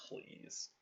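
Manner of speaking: breathy whispered please. Acted. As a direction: beg under your breath. Pleading quietly with uncle.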